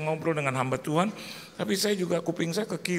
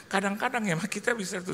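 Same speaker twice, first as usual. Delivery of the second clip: ordinary talk, nothing marked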